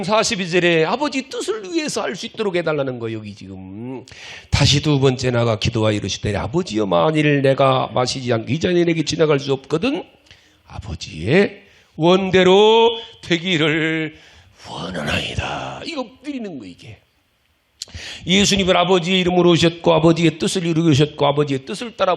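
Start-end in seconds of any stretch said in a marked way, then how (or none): 6.4-15.8 scripture reading. Acted. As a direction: intone the verse with reverence. Reads Jesus' prayer.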